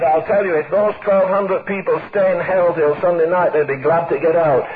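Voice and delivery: put-on low-pitched, unhurried reply of an old preacher; low, dry quoted preacher; Nicholson. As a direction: drop the voice well down, matter-of-fact. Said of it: "He said".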